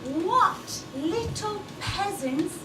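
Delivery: haughty. Acted. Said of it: "we look at them and we say".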